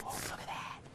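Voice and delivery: hushed, whispered mock admiration; mock awed whisper. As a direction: whisper it like an impressed show-off. Mimics show-offs admiring their shuffle.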